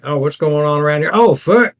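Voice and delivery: rising panicked shout; startled outburst. Retold or imitated. Player acting his character's shock at the fire. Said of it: "I was like".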